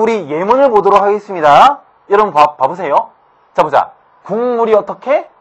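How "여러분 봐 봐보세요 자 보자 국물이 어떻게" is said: usual speaking voice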